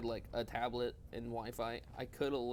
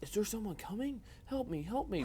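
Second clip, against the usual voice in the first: imitating a trapped man; 9 semitones higher than usual. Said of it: "There's a guy yelling in here like".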